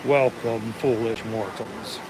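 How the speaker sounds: deep, slow ghostly host voice; dark lord. Pretend. Quoting the Haunted Mansion ghost host.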